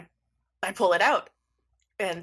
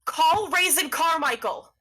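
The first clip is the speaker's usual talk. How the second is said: high, louder drawn-out plea; panicking; 9 semitones higher, 4 dB louder than usual. Acted. Cosmo begs the phone to call Raisin, panicking. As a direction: wail the name in rising panic. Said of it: "they start panicking".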